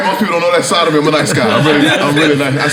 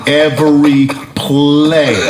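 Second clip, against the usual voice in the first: ordinary delivery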